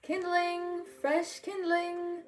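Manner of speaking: high, slow street-seller call; calling out wares. Acted. Mickey calling to passers-by, high street cry. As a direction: cry out kindling for sale. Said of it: "called Mickey".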